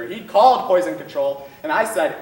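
normal talking voice

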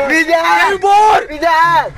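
sharp excited shouts; shouting. Real, not acted. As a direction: yell a warning at the screen. Shouting warnings watching the match.

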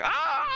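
high, acted scream of horror; hammed-up high "ah!"; mock horrified scream. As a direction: scream as if forced to watch. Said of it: "I'm looking at it going".

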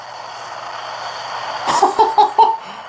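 a burst of high, breathy giggling; high giggle. Real, not acted. Watching the video, he bursts into high giggles. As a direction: crack up at the ridiculous ending. Laughing at every Kong on tower.

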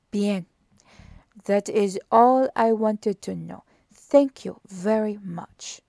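slow, deliberate detective question; slow careful Poirot inquiry. A narrator's reading. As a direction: lean on each word, probing. Poirot concludes.

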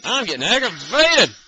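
exasperated sing-song complaint; aggravated. Real, not acted. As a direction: let the irritation rise and swing through the words.

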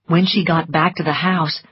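usual speaking voice

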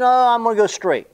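voicing the ball. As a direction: give the ball a silly little voice. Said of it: "it was sort of like it was looking for something".

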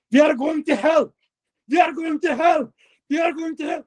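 high mock shout, chanting it over and over; retold shout. Driver taunting his terrified partner, high mock shouting. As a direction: yell it back at him like a taunt.

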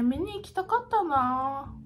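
normal talking voice

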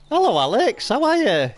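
put-on high, sing-song baby talk to a cow; lightly hammed-up cooing hello; mock sweet greeting. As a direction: coo at the animal like a pet.

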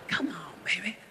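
breathy whispered lure; luring businessman down the alley, whispered; creepy whisper. Retold or imitated. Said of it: "I go".

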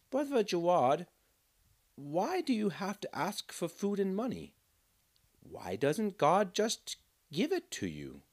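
put-on a child who truly wants to know; high puzzled little boy; curious boy. Boy's voice.

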